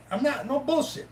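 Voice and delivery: ordinary talk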